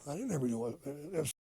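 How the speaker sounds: ordinary delivery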